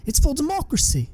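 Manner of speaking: high, loud, earnest hero voice; Obi-Wan insisting. A narrator's reading. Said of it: "Obi-Wan replies".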